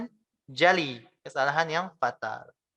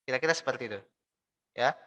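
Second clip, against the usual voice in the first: ordinary talk, nothing marked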